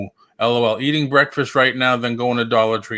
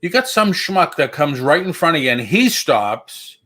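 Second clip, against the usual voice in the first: usual speaking voice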